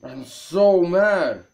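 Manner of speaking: slow, restrained fury; real anger, kept low; seething. Real, not acted. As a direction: state it slowly, holding the anger in. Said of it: "furious right now".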